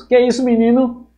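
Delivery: mother scolding her son for the rude word, high and loud; shocked mother. Retold or imitated. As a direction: snap back at the child like a startled mother, high and loud.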